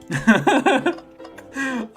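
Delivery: real high giggle; high-pitched delighted laughter; amused laugh. Real, not acted. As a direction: burst out laughing. Amused by the cowboy line.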